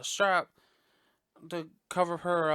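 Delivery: ordinary delivery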